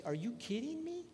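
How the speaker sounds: high-pitched mock disbelief; incredulous question, pitched well up; Jesus. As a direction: toss it out high, playfully exasperated. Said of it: "Jesus say".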